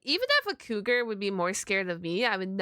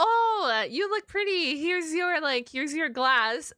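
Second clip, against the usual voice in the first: higher, playful pickup-line voice; mock flirty guy; 6 semitones higher, slower than usual.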